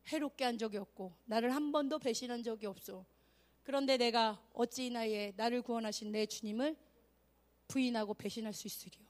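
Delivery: solemn measured reading, low and soft; Polycarp. Acted. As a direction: read the martyr's answer low and steadily. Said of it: "지금 폴리캅이 뭐라고 말하는 거야? 읽어보자".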